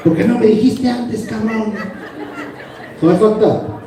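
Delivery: ordinary talk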